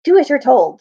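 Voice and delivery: loud, stern rebuke; stern command. Acted. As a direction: put him in his place. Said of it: "said Glunstorm the centaur".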